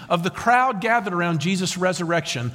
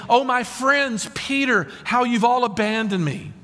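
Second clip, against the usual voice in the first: mock lament; 6 semitones higher than usual. Preacher voices what Jesus isn't saying to his friends, mock lament. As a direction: imitate a wounded plea, pitched up. Acted lament of being abandoned.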